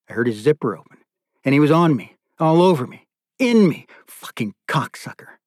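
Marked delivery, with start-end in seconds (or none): none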